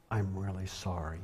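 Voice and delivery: low, very soft retold apology; subdued apology. Wife apologizing to the man her husband yelled at, subdued. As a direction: apologize gently, dropping low and quiet.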